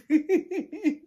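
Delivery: a real high laugh, breaking up; high burst of laughter. Real, not acted. Laughing at forgetting the friends' names, high laugh. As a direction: laugh at your own slip with names.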